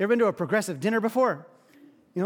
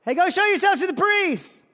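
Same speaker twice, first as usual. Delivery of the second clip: higher, louder casual yell across a distance; Jesus imitated yelling the command back to the lepers; 8 semitones higher, 4 dB louder than usual. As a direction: toss the order back with a shout. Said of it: "yells back".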